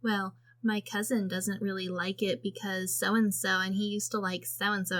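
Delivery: soft, murmured mock gossip; imitated tattletale. Kid explaining family backstory, soft mock-tattletale delivery. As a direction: rattle off family tales quietly, unimpressed. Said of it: "She just says it in the".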